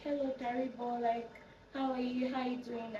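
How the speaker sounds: lightly mimicked phone small talk; friend on the phone. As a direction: put on the caller's friendly greeting. Voicing the friend who phoned.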